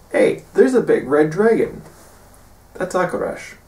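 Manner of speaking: suddenly spotting the dragon; sudden realization. Retold or imitated. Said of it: "eventually they realize".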